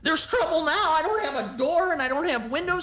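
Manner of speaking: loud, high-pitched indignation; exasperated homeowner, high and loud; outraged older woman. Acted. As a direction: rail at the intruders over the damage. Game master plays the outraged older woman.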